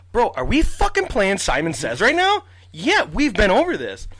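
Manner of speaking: high, loud, slow exasperated outburst; exasperated rant. Retold or imitated. To the border agent repeating questions, loud exasperated complaint. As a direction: spell it out to someone who isn't listening.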